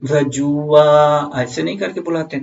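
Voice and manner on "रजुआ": रजुआ stretched very slowly; drawn-out nickname call. Shown as a demonstration. Teacher calling Raju from afar, रजुआ stretched slowly. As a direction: call रजुआ across a distance. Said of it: "तो हम कैसे बुलाते हैं?".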